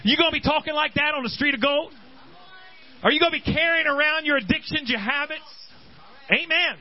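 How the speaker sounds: fervent preaching. Acted. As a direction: lift the church with your voice. Preacher challenging the congregation.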